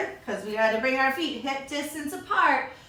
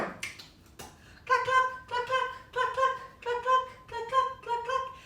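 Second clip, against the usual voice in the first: high, soft little clucks; hen clucking; 11 semitones higher, 3 dB quieter than usual. Acted. Teacher clucks as a hen during chicken pose, high. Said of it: "Maybe we pick up some corn".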